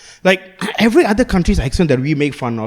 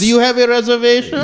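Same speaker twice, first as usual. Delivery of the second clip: mock doorman voice; 7 semitones higher than usual.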